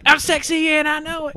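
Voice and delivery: high, far-off cocky voice; mock sexy boast. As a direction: strut it like a pop song. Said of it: "I'll be like".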